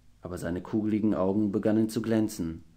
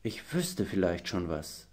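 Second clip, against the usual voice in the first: thoughtful; 4 semitones lower, 8 dB quieter than usual. A narrator's reading. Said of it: "sagte er nachdenklich".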